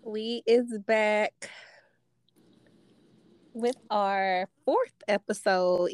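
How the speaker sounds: drawn-out intro. Acted. Slow drawn-out show intro.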